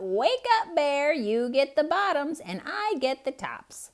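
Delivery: high, bright calling voice of a sly hare. Acted. Hare calling Bear awake at harvest, high call. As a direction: sing it out to wake the sleeping bear. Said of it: "Hare called out".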